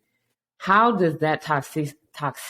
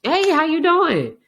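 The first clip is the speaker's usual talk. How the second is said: much higher and louder, slow sunny hello; bright cheery greeting; 5 dB louder than usual. Retold or imitated. Host greets the friend on day two, high and cheery. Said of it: "Then day two is".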